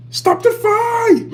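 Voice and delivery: high, loud, drawn-out cry; mock yelled game voice. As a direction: imitate a game's piercing slow yell. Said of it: "somebody's yelling".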